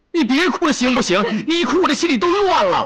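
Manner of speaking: high, frantic begging; flustered pleading. Acted. Young man begging a crying girl to stop, flustered pleading. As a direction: plead helplessly, thoroughly rattled.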